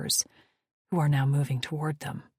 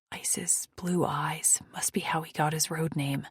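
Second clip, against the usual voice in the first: plain reading voice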